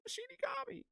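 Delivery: very high squeaked correction. Real, not acted. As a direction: squeak the word out.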